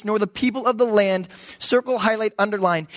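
ordinary talk, nothing marked

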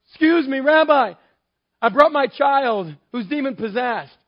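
put-on high, loud urgent plea for a sick child; polite interrupter. A man calls out to Rabbi for his son, high and loud. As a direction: call out over the crowd to the teacher. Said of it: "I can see interrupting Jesus".

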